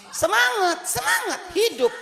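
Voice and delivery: very high cheering, full of energy; fired up. Retold or imitated. Husband waking up energized, very high and eager. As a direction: cheer it like a man reborn.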